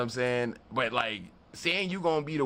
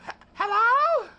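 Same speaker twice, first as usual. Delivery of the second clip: much higher, louder hammed-up hello; Goldie's hello; 8 dB louder, slower than usual. Narrator doing Goldie answering the door. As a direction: squeal it like Goldie, drawn out. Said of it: "How Goldie does it".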